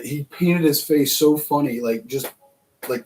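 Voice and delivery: ordinary delivery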